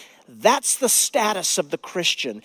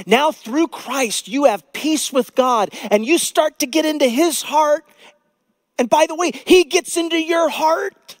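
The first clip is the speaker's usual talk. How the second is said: fervent pulpit proclaiming; full-force preaching; 9 semitones higher, 5 dB louder than usual. Acted. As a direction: preach it out to the back row, rising.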